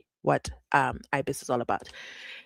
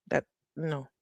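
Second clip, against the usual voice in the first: ordinary talk, nothing marked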